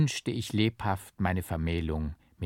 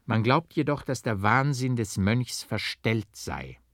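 usual speaking voice

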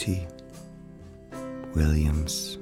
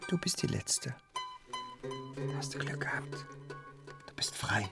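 very soft, private murmur; quiet, half-whispered aside; hushed murmur; 13 dB quieter than usual. Acted. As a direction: murmur it under your breath. Murmuring to a freed woodlouse.

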